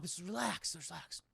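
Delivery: soft, fading reassurance; hushed mock soothing; soothing calm. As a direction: settle her gently. Calming Annie down.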